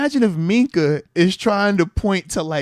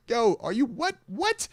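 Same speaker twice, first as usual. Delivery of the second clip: high, incredulous "what?"; can't believe what you're hearing; rising shocked what; stunned disbelief; 6 semitones higher, 3 dB quieter than usual. Retold or imitated. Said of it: "I'm like".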